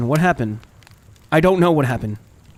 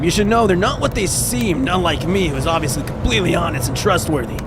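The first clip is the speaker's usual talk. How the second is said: Trapper character voice; 3 semitones higher than usual. Acted.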